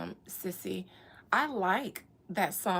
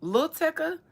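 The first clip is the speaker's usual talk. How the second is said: hype ad-lib; 7 semitones higher than usual. Pretend.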